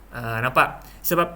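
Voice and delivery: ordinary delivery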